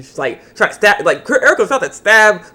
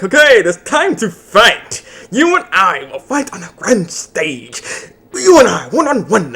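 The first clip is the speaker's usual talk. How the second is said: slow, higher hammed-up battle challenge; challenging the hero; 4 semitones higher, 4 dB louder, slower than usual. Rival challenges the hero to a duel, slow hammed-up voice. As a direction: drawl it out like a rival calling for a duel. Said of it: "He's like".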